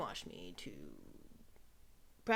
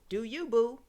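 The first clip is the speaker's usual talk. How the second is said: quoting a friend; 7 semitones higher, 12 dB louder than usual. Retold or imitated. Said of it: "My YouTuber friend says".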